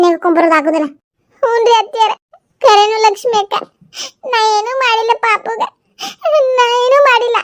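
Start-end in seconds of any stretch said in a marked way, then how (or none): 1.4-7.4 high, whimpering denial; high tearful young woman voice. Acted. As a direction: plead innocence close to tears.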